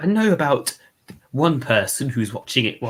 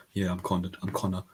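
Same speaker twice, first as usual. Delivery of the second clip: lower, much softer actor voice; Connor impression; 4 semitones lower, 10 dB quieter than usual. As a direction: murmur it like the cravat Doctor. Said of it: "I could probably do Connor".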